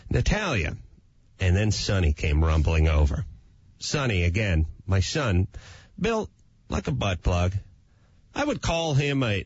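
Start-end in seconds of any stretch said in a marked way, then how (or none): none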